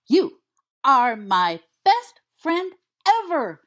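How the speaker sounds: slow, emphatic excited bark; enthusiastic bark. A narrator's reading. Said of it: "Sirius barks very enthusiastically".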